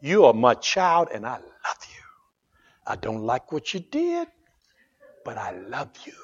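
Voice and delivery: hushed, fading to a breath of a whisper; soft fatherly reassurance in the ear; tender whisper. Retold or imitated. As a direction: start gently and sink into a whisper. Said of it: "still whispers in your ear".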